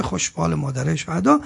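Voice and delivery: ordinary delivery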